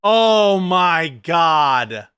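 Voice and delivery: long, drawn-out "oh my God"; slow, appalled exclamation; real disbelief. Real, not acted. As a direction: stretch every word in drawn-out dismay. Exasperated reaction to the debate clip.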